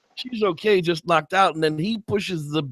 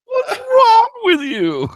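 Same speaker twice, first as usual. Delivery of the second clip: high, slow, crying shriek; freaking out; much slower than usual. Retold or imitated. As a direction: drag it out in a panicked wail. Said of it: "He's freaking out".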